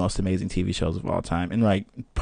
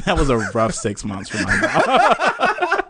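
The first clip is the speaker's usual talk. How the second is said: slower, louder, breaking into laughter; laughing; 4 semitones higher, 6 dB louder, slower than usual. Real, not acted. Guest recalling a childhood biting phase, laughing. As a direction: let the laugh spill through the words.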